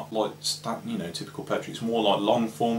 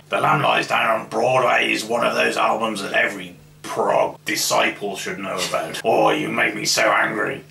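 Hector the Prog God; 6 dB louder than usual. Pretend. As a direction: thunder down at a puny mortal. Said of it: "Hector the Prog God".

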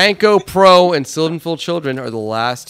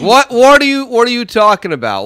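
startled shout; 11 semitones higher, 8 dB louder than usual. Real, not acted. Teammate suddenly yelling numbers.

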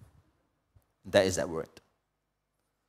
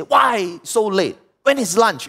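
mock impatient complaint, pitched up; impatient demand; 9 semitones higher, 4 dB louder than usual. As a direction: demand it like a hungry baby, drawn out at first. Acts out impatient baby demanding food.

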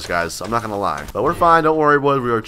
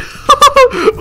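squealing high laugh; high laughing burst; 5 dB louder than usual. Real, not acted. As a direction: let out a shrill-pitched giggle burst. Laugh after attack.

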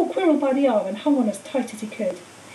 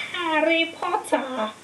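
higher, slow, breathy menace; eerie whisper; 5 semitones higher, much slower than usual. A narrator's reading. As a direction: draw the name out like a snake. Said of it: "it whispered".

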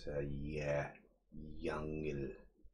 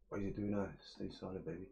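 ordinary talk

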